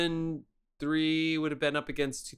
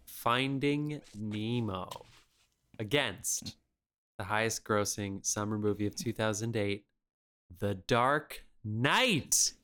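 dramatic announcer; 4 semitones lower, 3 dB quieter, much slower than usual. Acted. Announcing the next matchup.

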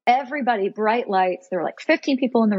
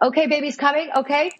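higher-pitched imitation of excited medics; rising urgency; 6 semitones higher than usual. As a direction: announce it like a TV-show birth team. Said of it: "start getting a little loud".

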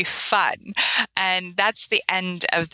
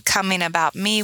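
ordinary delivery

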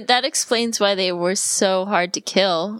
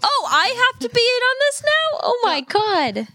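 exasperated disbelief; 13 semitones higher than usual. Real, not acted. Mock-outraged continuation.

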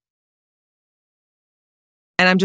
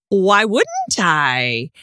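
slow drawn-out question; much slower than usual. Acted. Improv question game.

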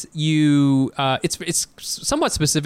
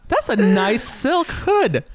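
mock admiring; 7 semitones higher, much slower than usual.

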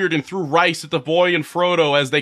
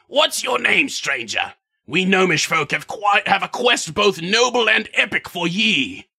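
gnome voice. Acted. Gnome addresses the boy.

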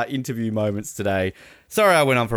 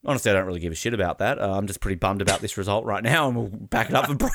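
mock blunt player; 5 semitones lower, 3 dB quieter, faster than usual. Imitated annoyed player reply.

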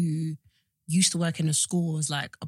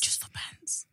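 whisper; 11 dB quieter, much slower than usual. Real, not acted. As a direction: repeat it in a whisper. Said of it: "echoed in whisper".

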